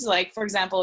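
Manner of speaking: ordinary delivery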